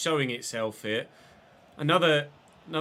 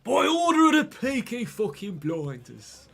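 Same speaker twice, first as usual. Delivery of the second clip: loud, high, drawn-out Brummie impression; Brummie accent; 10 semitones higher, 7 dB louder, slower than usual. Shown as a demonstration. Streamer shows viewers his Peaky Blinders voice, hammed-up Brummie accent. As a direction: belt it out in a slow, exaggerated Brummie accent. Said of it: "I can do a Brummie accent".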